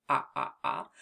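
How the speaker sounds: deep repeated "ah"; short o sound. Shown as a demonstration. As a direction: drop the voice low on "ah". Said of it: "in the word on".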